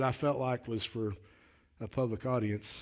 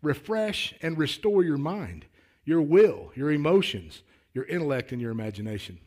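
higher, louder pulpit proclamation; emphatic preaching; 5 semitones higher, 6 dB louder than usual. Acted. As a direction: preach it out, hitting each item of the list.

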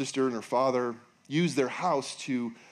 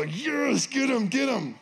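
high, eager cheer; 10 semitones higher, slower than usual. Pretend. Moviegoer cheering the hero on, high and eager. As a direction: egg the hero on.

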